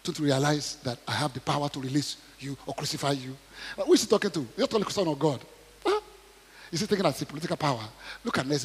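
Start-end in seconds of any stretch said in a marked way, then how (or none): none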